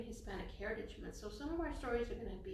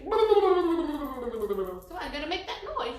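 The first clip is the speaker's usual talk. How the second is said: silly voice; 4 semitones higher, 15 dB louder, much slower than usual. Pretend. Silly shakes warm-up.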